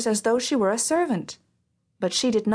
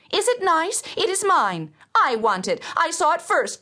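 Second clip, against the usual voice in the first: whiny stepsister; 8 semitones higher than usual. A narrator's reading. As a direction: whine it like a spoiled child. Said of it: "cried the other".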